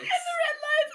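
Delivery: high, loud squeal through laughter; real laughing fit; helpless laughter. Real, not acted. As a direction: choke the words out laughing. Repeats friend while cracking up.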